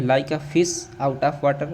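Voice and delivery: each word drawn out slowly; slow classroom dictation; slow dictation pace. Shown as a demonstration. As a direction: let the class hear every word. Teacher repeating the idiom slowly.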